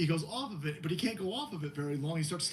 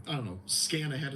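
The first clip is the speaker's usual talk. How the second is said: ordinary delivery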